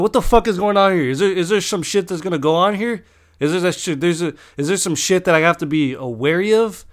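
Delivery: heated, incredulous demand, acted out; acted confrontation. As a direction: replay squaring up for a fight. Acts out his alarmed, combative thoughts.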